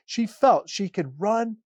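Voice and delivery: expressive read-aloud storytelling; story narration. A narrator's reading. Pastor reading the Easter story to families. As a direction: tell it warmly, like to children.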